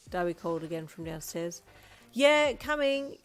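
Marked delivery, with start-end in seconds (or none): 2.1-3.2 loud, slow, exasperated call; fed up but answering. A narrator's reading. Wife calling to husband, exasperated. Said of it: "exasperated voice".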